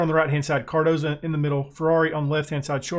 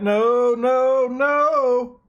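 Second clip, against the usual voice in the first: each no higher and louder; climbing alarmed protest; real dismay as the attack comes in; rising no; 7 semitones higher, 3 dB louder, much slower than usual. Real, not acted. Reacting to a near goal.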